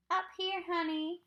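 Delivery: a small boy playing grown-up husband; best husband voice. A narrator's reading. Fudge calling down from the tree as a pretend husband. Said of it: "in his best husband voice".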